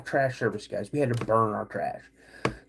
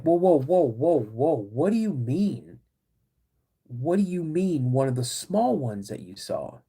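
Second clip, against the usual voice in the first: repeated whoas, then a slow question; taken aback. Retold or imitated. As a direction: act out stunned disbelief, stopping him short. Family stunned by brother's revelation.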